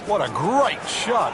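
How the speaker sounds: excited. Real, not acted. Admiring a shot in commentary.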